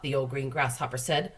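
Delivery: ordinary delivery, nothing marked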